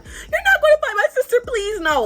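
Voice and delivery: very high, pleading wail, falling off at the end; mock cry for help. Mocking the girl pleading for her sister, exaggerated cry for help. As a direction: exaggerate her plea as if begging to be rescued. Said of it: "sounded like a cry for help almost".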